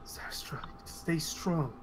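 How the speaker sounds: soft, slow reassuring whisper; slow tender whisper. Acted. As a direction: comfort her quietly. NPC whispers comfort.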